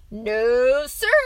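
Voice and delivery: loud, rising objection; crying out. A narrator's reading. Father refuses son's wolf idea, crying out. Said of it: "cried the man".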